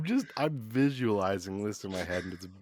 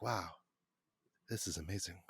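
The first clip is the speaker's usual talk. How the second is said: hushed, lower, put-on French accent; awed director murmuring with a French accent; 4 semitones lower, 7 dB quieter, faster than usual. Awed director walking onto a set, soft French accent. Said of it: "I gave him a French accent".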